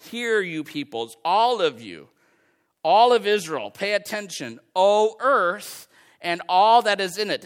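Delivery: building from steady to loud reading; scripture proclaimed. Acted. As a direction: call it out to the nations. Reads scripture with rising force.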